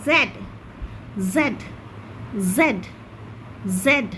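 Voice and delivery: British "জেড" for Z, spaced drill; British-style "জেড" repeated for the class. Shown as a demonstration. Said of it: "ব্রিটিশে বলবো".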